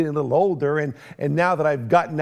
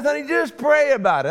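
higher, louder sweet wife plea through a laugh; mock wife voice; 9 semitones higher, 3 dB louder than usual. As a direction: play the wife coaxing, half laughing.

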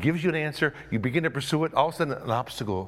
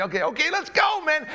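eager troops; 12 semitones higher than usual. Acted. Men eagerly setting off.

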